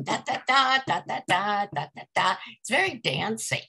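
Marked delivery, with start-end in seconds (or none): 0.0-2.4 sing-song da da da, with the middle beats lifted high; "da, da, da" chanted like a dancing nursery rhythm; anapaestic rhythm. Shown as a demonstration. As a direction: tap out a bouncy triple beat on "da". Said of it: "anapaests that are a tripling rhythm".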